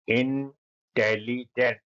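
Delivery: Intelligent drawn out slowly, each syllable apart; slow syllable demo. Shown as a demonstration. Teacher to pupils, syllables stretched very slowly. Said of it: "उच्चारण करो जिससे आपका pronunciation अच्छा हो".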